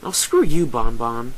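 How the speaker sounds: loud, flustered retort; indignant comeback. Acted. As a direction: throw it back loudly. Flustered comeback after being taunted.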